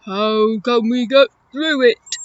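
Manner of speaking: loud, rounded elephant voice; Eddie the elephant. Acted. Elephant asking about the pile of rocks, loud creature voice. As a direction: boom it like a storybook elephant. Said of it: "let me do my elephant voice".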